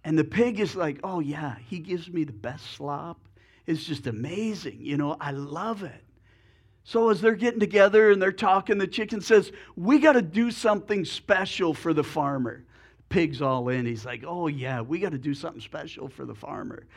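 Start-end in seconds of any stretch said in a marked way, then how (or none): 1.0-6.0 softer, lightly voiced pig savoring his slop; contented pig. Pig telling the chicken about the farmer, soft and content. 14.2-16.9 lower, softer, fast pig agreeing; eager pig. Acted. Pig echoing the chicken's idea, low and quick.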